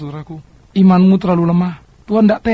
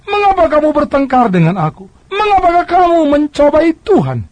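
Moses rebuking; 9 semitones higher, 4 dB louder than usual. Acted. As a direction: scold them like an exasperated Moses.

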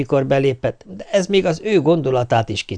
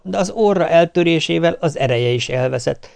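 ordinary delivery

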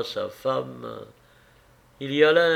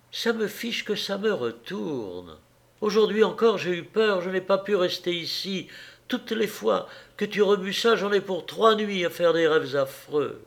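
higher, pleading wife haunted by fear; a frightened woman begging him to stop; anxious wife, higher voice; distressed wife; 6 semitones higher than usual. Acted.

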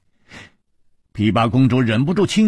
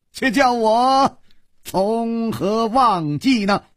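laughing exultation; 9 semitones higher than usual. A narrator's reading. Chu Liuxiang answers the princess with a Zen riddle, exultant after laughing. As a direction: proclaim it grandly, stretching the last words. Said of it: "楚留香大笑".

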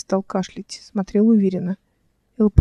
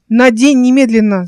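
loud, raised-pitch urgent order; urgent shout; 3 semitones higher, 9 dB louder than usual. A narrator's reading. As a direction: cry out a command in alarm. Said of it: "закричала Эл". (громкий приказной крик)

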